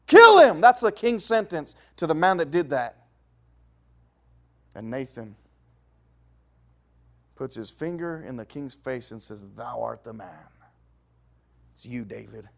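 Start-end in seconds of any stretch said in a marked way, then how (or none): 0.0-0.6 furious, much higher and louder sentence; king David's furious verdict; angry king. Acted. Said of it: "fuming mad". 9.5-12.5 much softer, lower prophetic verdict; a hushed, grave accusation; solemn accusation. Acted. Prophet confronts the king.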